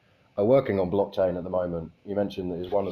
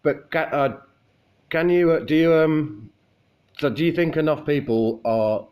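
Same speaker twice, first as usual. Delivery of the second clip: louder, higher and careful, with hesitant restarts; speaking up clearly; 7 semitones higher, 5 dB louder than usual. Real, not acted. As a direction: speak up for a listener struggling with your accent. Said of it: "I'll try and speak clearly".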